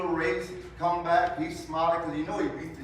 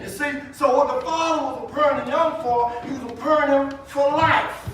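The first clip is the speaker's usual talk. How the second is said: loud, higher preacher's build; preaching at full force; 6 semitones higher, 6 dB louder than usual. Acted. Preacher pressing the congregation toward Jesus, full-force preaching. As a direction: raise it to a fervent preaching peak.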